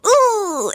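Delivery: high, drawn-out "ooh"; game-character mess-up cry. Retold or imitated. Guest imitates her skater messing up, high pained "ooh". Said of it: "mess up and go".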